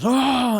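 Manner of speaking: loud high yelp; startled, drawn-out holler; alarmed scream. Acted. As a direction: cry out as the barrel crashes. Character yells as barrels crash.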